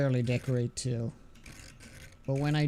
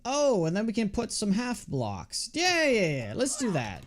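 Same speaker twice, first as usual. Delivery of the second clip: excited planning; 7 semitones higher than usual. Real, not acted. Hitting on a building solution.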